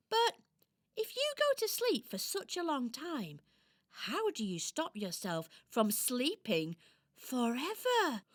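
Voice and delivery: curious little doll. Acted. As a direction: ask like a puzzled little toy.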